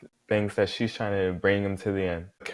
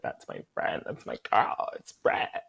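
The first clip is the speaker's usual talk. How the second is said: ordinary talk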